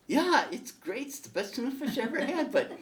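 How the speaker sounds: high, loud Harry voice; Harry praising, then asking. Acted. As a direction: gush about the tuna, then raise a question. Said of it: "he says".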